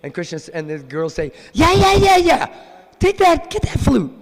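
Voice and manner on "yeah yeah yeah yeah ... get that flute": very high, loud squeaky chorus of yeah; squealing friends urging Radharani on; pushy girlfriends. Acted. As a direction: egg her on like giddy teens. Girls urge her to steal.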